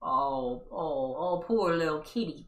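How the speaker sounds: usual speaking voice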